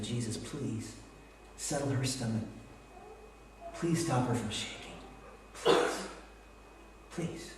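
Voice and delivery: soft, low plea to Jesus; retold pleading prayer. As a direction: pray quietly, pausing between pleas. Retold prayer over his sick wife.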